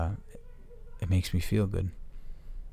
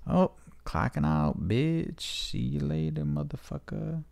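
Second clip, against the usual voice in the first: high, slow, sneering driver voice; hammed-up taunting courier; mocking delivery driver; 8 semitones higher, slower than usual. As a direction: play the driver gloating as he leaves. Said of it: "they're probably gonna be like".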